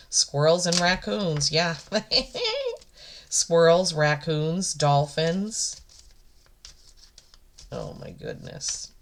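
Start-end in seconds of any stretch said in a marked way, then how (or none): 1.8-2.8 high-pitched, emotional little laugh; teary laugh. Real, not acted. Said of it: "I'm very emotional right now". 7.7-8.9 overcome, soft whispered exclamation; real overwhelmed whisper; emotional whisper. Real, not acted. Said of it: "I'm very emotional right now".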